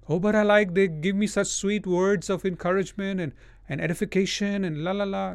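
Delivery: high-pitched, dismissive imitation of a gushing fan; mock protesting follower. Preacher imitating lovers of sweet prophecy, mock gushing. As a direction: mimic a fawning objector, trailing into la-la-la.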